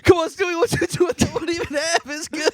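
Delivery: high, loud exasperated outburst; mock shouting. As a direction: yell it as an over-the-top parody. Replaying contest scene at full tilt.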